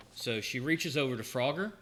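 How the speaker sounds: ordinary talk, nothing marked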